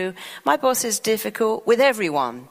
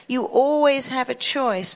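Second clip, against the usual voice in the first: normal talking voice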